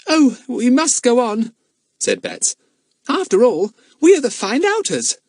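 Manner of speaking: put-on eager little girl telling news; young girl Betts. A narrator's reading. Girl insisting.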